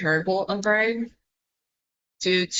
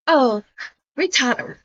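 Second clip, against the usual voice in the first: mock outburst; 6 semitones higher than usual. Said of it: "me, like".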